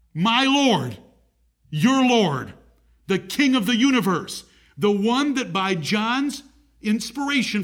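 fervent pulpit preaching. Acted. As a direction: build the sermon to a fervent peak. Preacher builds to a climax.

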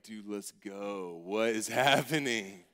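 low, slow and drawn-out; awed pupil reaction. Retold or imitated. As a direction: drag it out low, like an amazed schoolkid.

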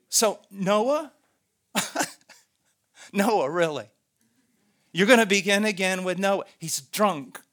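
loud, incredulous railing; railing accuser. Acted. Accuser objects to God beginning again with Noah, railing. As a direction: challenge God in scornful disbelief. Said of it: "a railing in their voice".

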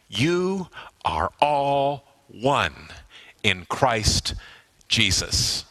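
very slow, with weighty pauses between phrases; measured, spaced-out scripture quote; slow, deliberate emphasis. Real, not acted. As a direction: let each word land slowly on the listeners. Preacher presses scripture on listeners.

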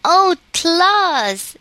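high, loud cry of wonder; delighted cry. A narrator's reading. Mayre seeing the silk-robed dolly, crying out high and loud. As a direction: gasp it out with childish rapture. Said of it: "she cried".

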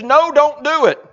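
a touch of panic; alarmed refusal. Acted. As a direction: Martha objecting at the tomb, hammed-up alarm. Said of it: "she says".

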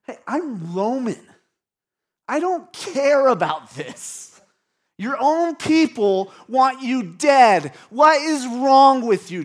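loud, high, scornful outburst as a Roman governor; defensive, mean. Acted. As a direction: lash out defensively, leaning hard on the words. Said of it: "He's defensive. He's sarcastic. He's mean".

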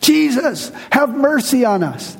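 high-pitched imitated cry of the lepers; acted plea hailed from a distance. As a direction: call out for mercy, pitched well up. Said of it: "they start crying out".

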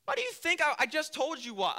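high, loud, exasperated comeback; mock exasperation. Boaz answers Ruth's fishing question, mock-exasperated and high. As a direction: throw the question back, voice jumping up.

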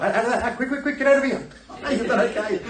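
high, loud, hurried shooing; hammed-up panic, hustle them out fast; panicky Joseph. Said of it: "so Joseph is thinking".

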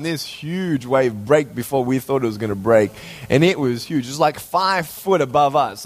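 usual speaking voice

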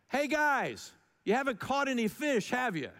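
high casual shout from the shore, hey guys; calling out to fishermen. Retold or imitated. Jesus calls to the tired disciples, casual hail. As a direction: call across the water like a buddy.

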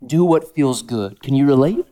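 normal talking voice

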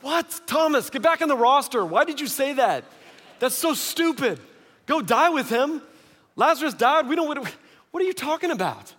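high-pitched mock exasperation; exasperated disbelief. Apostles scolding Thomas for speaking up, high and exasperated.

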